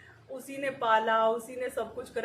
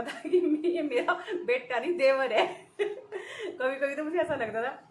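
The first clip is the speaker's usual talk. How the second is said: laughing; 3 semitones higher, 4 dB louder than usual. Real, not acted. Joking about son, laughing.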